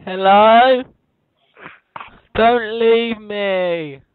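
usual speaking voice

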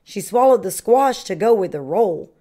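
ordinary delivery, nothing marked

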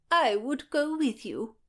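high-pitched show of concern; false concern. A narrator's reading. Isabel offers to accompany Anne, falsely concerned. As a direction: offer it with sugary, doubtful sincerity. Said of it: "with false, or perhaps genuine concern".

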